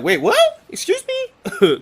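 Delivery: high, loud mock incredulity; shocked disbelief. Uncle Phil reacting to Will's slang, shocked disbelief. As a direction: jump way up in pitch, as if appalled.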